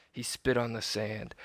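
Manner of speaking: usual speaking voice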